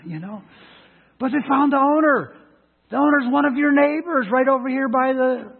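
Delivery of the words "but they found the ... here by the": loud, higher, slow preaching voice. Acted. Preacher telling the congregation the dog's fate, loud slow preaching. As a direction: drive the point home slowly.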